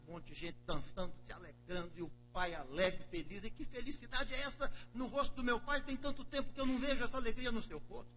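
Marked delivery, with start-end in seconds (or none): 3.4-8.1 higher, questioning the sudden party; indignant older brother. Acted. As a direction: demand to know why there's a party. Broer ziet vader blij.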